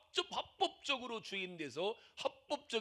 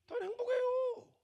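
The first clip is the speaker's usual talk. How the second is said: much higher, sing-song contentment; high sweet reply. Retold or imitated. Pastor replays his own cheerful reply, much higher. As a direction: chirp the answer brightly high. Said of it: "pastor replays own reply".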